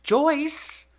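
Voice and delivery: very high, loud scandalized cry of the name; mother yelling at grandma; shocked scream. Retold or imitated. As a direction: shriek it in outrage. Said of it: "my mother screamed".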